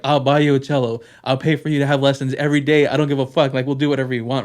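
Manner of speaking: loud, insistent retold promise; emphatic wife. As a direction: push it like she would buy anything.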